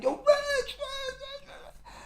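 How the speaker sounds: desperate high scream, fading out; screaming name. Retold or imitated. Brother screaming for Rich down the block, desperate high scream. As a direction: shriek his name down the block. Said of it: "I hear Randall scream like".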